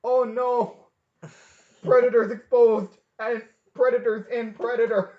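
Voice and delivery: high, loud outcry; shouted dismay. Real, not acted. Podcaster reacting as co-host brings up Predators, shouted dismay. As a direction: holler it out in comic horror.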